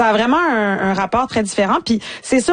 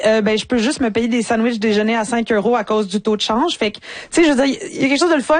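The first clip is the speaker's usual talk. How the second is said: normal talking voice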